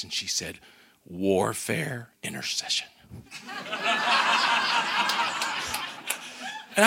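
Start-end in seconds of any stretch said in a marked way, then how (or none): 1.1-2.9 low, soft and intense, holding back fire; quiet intensity with a hint of fire; fiery, quiet answer. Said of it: "she looks up with this fire in her eyes". 3.1-6.7 delighted laugh. Real, not acted. Delighted at her surprising answer.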